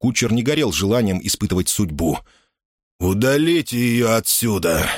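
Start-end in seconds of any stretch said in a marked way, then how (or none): 3.0-4.9 slow, laboured command; winded bishop's drawn-out order; straining for breath. A narrator's reading. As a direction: order it heavily, dragging out each word. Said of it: "Натужно дыша велел епископ".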